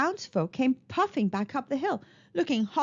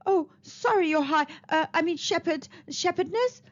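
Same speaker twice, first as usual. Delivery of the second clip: flustered, high, stumbling words; stuttering; 6 semitones higher than usual. Acted. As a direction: stammer it out nervously. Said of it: "stuttered the man".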